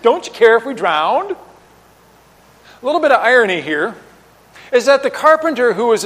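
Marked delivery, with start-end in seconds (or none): 0.0-1.3 pointed sarcastic question rising at the end. Acted. Disciples asking Jesus instead of asking nicely, sarcastic. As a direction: throw it out as a sarcastic jab. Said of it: "he asked sarcastically".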